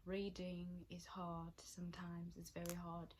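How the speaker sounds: slow, unvoiced whisper; slow whispered self-mocking aside. Real, not acted. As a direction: confide it under your breath.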